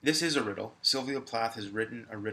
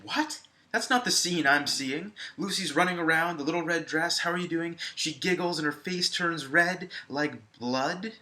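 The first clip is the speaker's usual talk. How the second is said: higher and louder, acting a student's disbelief; mock outraged student; 5 semitones higher, 6 dB louder than usual. Teacher voicing a student objecting to the simile, incredulous. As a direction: play the baffled student protesting the simile. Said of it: "I hope you're saying".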